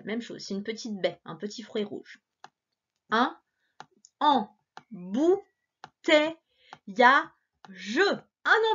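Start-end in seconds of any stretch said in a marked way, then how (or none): 3.1-8.7 embouteillage stretched very slowly; slow sounding out. Shown as a demonstration. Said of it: "essaie de lire avec moi".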